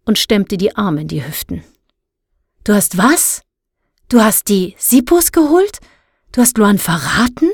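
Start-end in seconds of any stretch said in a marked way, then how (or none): 2.6-7.5 incredulous questions, pitch shooting up; aghast disbelief. A narrator's reading. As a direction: demand it, shocked by the betrayal. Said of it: "Sansibar blieb die Luft schier weg". (fassungslos empört)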